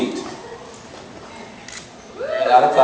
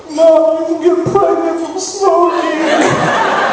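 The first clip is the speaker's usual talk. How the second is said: put-on high wailing little boy; crying kid voice; 12 semitones higher, much slower than usual. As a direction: wail it like a scared child. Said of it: "Philip again starts crying".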